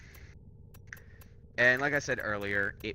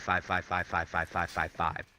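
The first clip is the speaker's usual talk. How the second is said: monotone repetition; 4 semitones lower than usual. Acted. Player hammering home that chances don't stack, monotone repetition. Repeats the same number in monotone.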